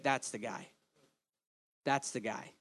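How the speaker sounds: low, soft, dry sarcasm; mock verdict. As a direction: understate it with ironic doubt. Mock church assessor picking Moses.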